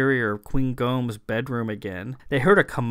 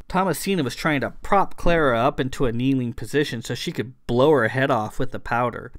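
plain reading voice

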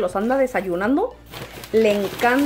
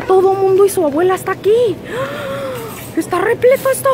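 higher, louder excited exclaiming; 9 semitones higher, 5 dB louder than usual. Real, not acted. Overwhelmed by the crowded Walmart lot, loud and higher. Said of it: "crowded parking lot".

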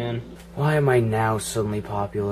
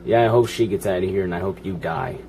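ordinary talk

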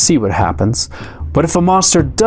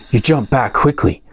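ordinary delivery

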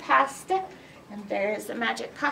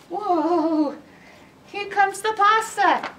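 alarmed; 7 semitones higher, 3 dB louder, slower than usual. Acted. Townspeople fleeing pasta.

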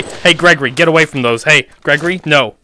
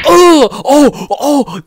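high yelps, easing off; hammed-up cries of pain; 8 semitones higher, 5 dB louder, much slower than usual. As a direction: cry out at each hit. Hit in game.

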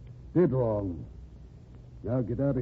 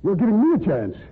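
angry retort; 6 semitones higher, 4 dB louder than usual. Acted. Editor flares up at blackmailer.

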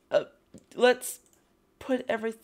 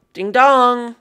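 loud sung two-note ding dong, pitched up; doorbell ding dong; 4 semitones higher, 11 dB louder than usual. Pretend. Player calling at a villager's door as a loud singsong doorbell. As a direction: ring the bell with your voice, playfully.

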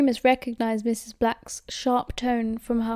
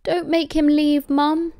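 higher, louder protest from a son; raised, imploring boyish appeal; pleading; 5 semitones higher, 5 dB louder, slower than usual. A narrator's reading. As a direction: plead urgently with your mum. Son pleads with his mother.

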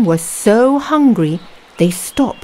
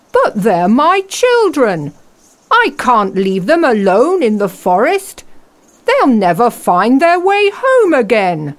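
worried father; 5 semitones higher than usual. Acted. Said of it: "said the woodcutter".

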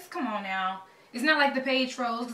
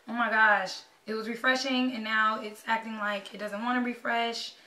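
ordinary delivery

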